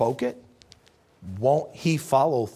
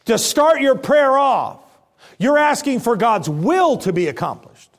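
fervent preaching; 5 semitones higher, 5 dB louder than usual. Acted. Preaching at full force.

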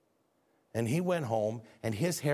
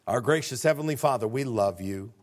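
closing prayer; 2 semitones higher, 5 dB louder than usual. Acted.

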